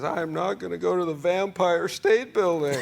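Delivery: put-on whiny kid refusing, half laughing; a touch of a crying boy; crying child voice. Crying son voiced in story.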